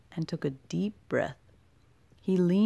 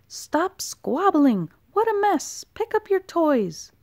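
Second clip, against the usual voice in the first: high, loud scolding yell; 11 semitones higher, 5 dB louder than usual. A narrator's reading. Mother toad yelling at her toadlets, high and loud. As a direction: scold the squabbling kids at full volume. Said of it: "her yelling inside".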